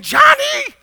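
very high, loud screeched name; piercing wake-up yell; mom's screech. Retold or imitated. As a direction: screech it like a mom waking a sleeper. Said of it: "it was the worst screech of the day".

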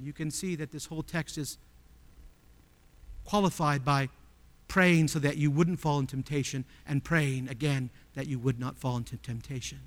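ordinary talk, nothing marked

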